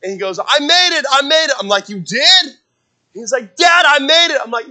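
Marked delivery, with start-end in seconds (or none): 0.4-1.5 loud, high mock boyish cheer; high-pitched excited boy. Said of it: "so it's really high pitch". 1.9-2.6 loud, high-pitched disbelief; astonished dad. Retold or imitated. Dad reacting to son's triumph. 3.6-4.4 loud, high mock boyish cheer; high-pitched excited boy. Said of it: "so it's really high pitch".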